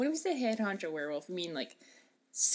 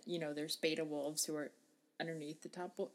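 usual speaking voice

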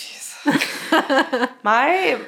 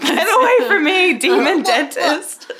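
groggy shouting; 8 semitones higher, 6 dB louder, slower than usual. Retold or imitated. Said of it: "Can you imagine me passed out".

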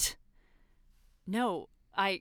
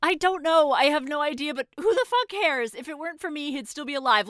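high and loud, self-hating; desperate shout; 8 semitones higher, 9 dB louder than usual. Acted. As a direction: yell it out in despair. Self-loathing shout at the priest.

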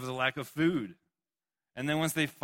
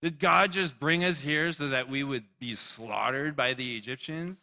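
slower, higher, louder accusing wail; panicked Israelites; 4 semitones higher, 5 dB louder than usual. Retold or imitated. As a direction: accuse Moses as a frightened crowd. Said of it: "they look at Moses and they're like".

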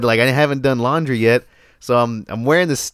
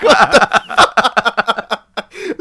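laughing disbelief; 8 semitones higher, 3 dB louder, much slower than usual. Retold or imitated. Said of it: "I was like".

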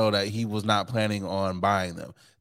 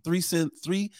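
put-on teen ordering voice; 7 semitones higher, slower than usual. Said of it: "He was like".

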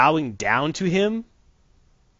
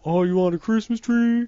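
cartoon kid voice; 8 semitones higher than usual. Cartoon kid offering Hitler a tree, cooing high. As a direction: coo at him like a sad pet. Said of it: "They're like".

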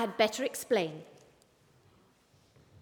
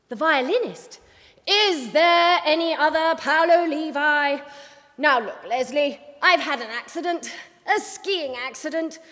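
louder, impatient boss voice; brisk boss voice; 3 semitones higher, 5 dB louder than usual. Acted. Boss snapping at her cub reporter, impatient. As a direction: clip it like a boss with no patience.

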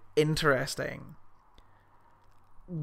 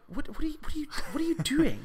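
high-pitched, stammering disbelief; mock bewilderment; 11 semitones higher, 5 dB quieter, faster than usual. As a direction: act baffled, restarting the question in a high voice. Mock-baffled question to the actor.

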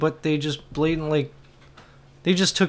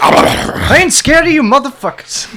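hype shout; 9 semitones higher, 11 dB louder than usual. Pretend.

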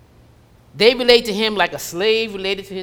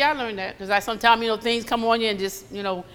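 ordinary delivery

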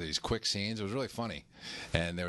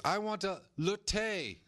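put-on high, slow Swedish trophy wife accent; 11 semitones higher, much slower than usual. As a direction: mimic a Swedish actress with a stretched latte. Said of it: "she says".

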